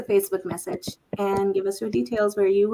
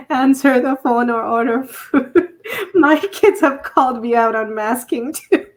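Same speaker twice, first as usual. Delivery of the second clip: laughing; 4 semitones higher, 6 dB louder than usual. Real, not acted. Host reading a viewer's comment about masking, laughing. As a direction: read it out through laughter.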